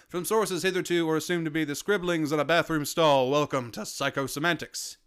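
brisk announcer read; podcast intro read. Acted. Opening the podcast for listeners, announcer read. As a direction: present the show with polish.

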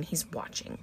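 breathy whispered warning; eerie whisper. Acted. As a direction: hush it like a secret threat. Ominous line read from cursed book.

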